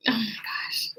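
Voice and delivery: breathy tearful whisper; whispered in tears. Real, not acted. Host overcome by the poem, whispering through tears. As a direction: let it slip out as a whisper while crying.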